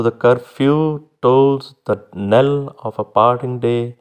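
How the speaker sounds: slow reading with a beat on each stressed syllable, tolls the loudest; metrical recitation. Shown as a demonstration. Teacher recites Gray's elegy to show its feet, slow and rhythmic. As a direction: scan the line slowly, one foot at a time. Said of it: "syllable को stress करना है और एक को unstressed रखना".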